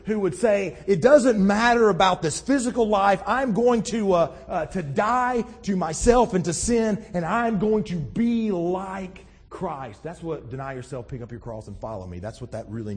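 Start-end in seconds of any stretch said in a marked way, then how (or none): 0.1-10.0 high, loud, impassioned preaching. Acted. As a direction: preach it out with full conviction. Preaches at full force.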